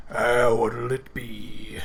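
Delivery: slow, low-voiced bored barman; orc barman. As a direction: lean on the bar and drawl it like a gruff orc.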